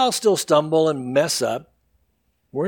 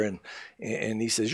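usual speaking voice

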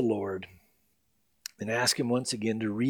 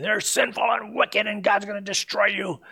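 higher-pitched mock fire-and-brimstone voice; mock condemning preacher; 5 semitones higher than usual. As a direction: condemn them in a put-on preacher voice. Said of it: "Instead of saying".

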